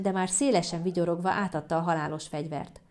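ordinary delivery, nothing marked

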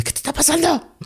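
very high-pitched imitation of a worried girlfriend; girlfriend's alarm. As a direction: mimic her waking you, high and urgent. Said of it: "me despertó mi novia así de". (imitando a la novia alarmada, voz muy aguda)

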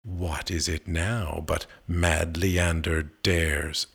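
usual speaking voice

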